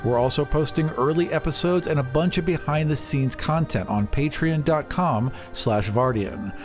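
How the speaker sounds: ordinary delivery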